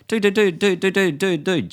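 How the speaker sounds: high, repeated patter to cut someone off; placating interruption. Acted. Acts interrupting an objecting audience member.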